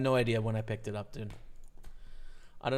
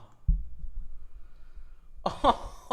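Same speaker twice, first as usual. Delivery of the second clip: breathy laugh; 9 semitones higher than usual. Real, not acted. Laughs after river.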